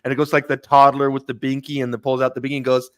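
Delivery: normal talking voice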